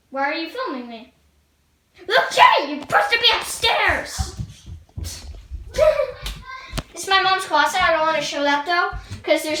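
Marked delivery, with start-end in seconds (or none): 2.1-4.4 loud high shout, teasing; yelling at brother. Real, not acted. Kid yelling at brother Luke J to go upstairs. 4.4-6.9 high-pitched laughing burst; high giggle. Real, not acted. Kid giggling after yelling at brother.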